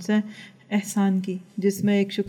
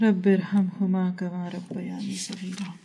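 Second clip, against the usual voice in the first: slow, devout Arabic recitation, drawn out; Quranic recitation; 4 dB quieter, slower than usual. Acted. Preacher reciting the prayer for parents, slow and devout. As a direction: intone the verse like a prayer.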